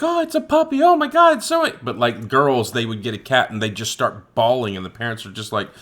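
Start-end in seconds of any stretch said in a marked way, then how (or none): 0.0-1.7 high, gushing squeal over a puppy; excited kid. Retold or imitated. Little boy opening a puppy gift, high excited squeal. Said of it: "little boys would get on and be like".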